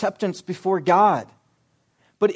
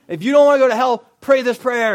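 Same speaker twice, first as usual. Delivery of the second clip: loud, high, forceful command; mock preacher yell; 5 semitones higher, 9 dB louder than usual. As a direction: pressure scared kids with a hammed-up shout. Acting yelling camp preacher pressing kids.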